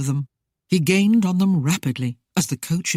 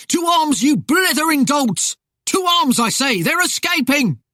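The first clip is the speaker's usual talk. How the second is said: urgent high-pitched shouted alarm; frantic commander rousing his men; loud commands; 10 semitones higher, 4 dB louder than usual. A narrator's reading. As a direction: shout the men to arms, voice far up. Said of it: "The loud commands".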